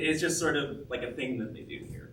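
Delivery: usual speaking voice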